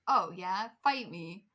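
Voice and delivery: loud, defiant mock dare; playfully acted bravado, punched out loud; cocky challenge. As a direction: throw down a challenge as your younger self. Retells defiant teenage self.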